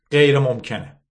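regretful yet unbending refusal; a touch of regret, firmly; sorry but firm. A narrator's reading. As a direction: say no kindly but leave no opening. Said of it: "لحنش متاسف اما محکم بود".

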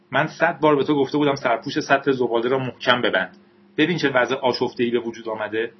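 ordinary delivery, nothing marked

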